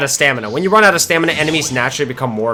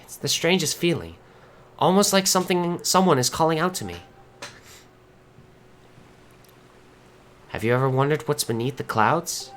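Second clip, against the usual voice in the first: soft, wondering confession; wistful friend character; 3 dB quieter than usual. Acted. Girl confides in Link about the world below, softly wistful. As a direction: play the friend confiding a secret dream, gently. Reads a game character's dialogue.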